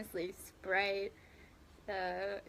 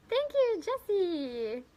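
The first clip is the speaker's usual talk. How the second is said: surprised gasp; 8 semitones higher, slower than usual. Real, not acted. Gasps at a viewer's gift.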